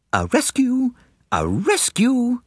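very high, gleeful rallying cry; excited girlish cry. A narrator's reading. Girl bursting into the smoking room, excited cry. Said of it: "cried Kitty Mooney".

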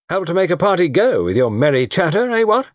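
high, loudish jovial jibe; mocking heartiness. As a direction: goad him, flipping up on the final words. Tony needles silent Carter.

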